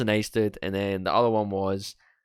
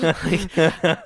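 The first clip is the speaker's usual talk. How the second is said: laughing; 7 semitones higher, 4 dB louder than usual. Real, not acted. Laughing at own story.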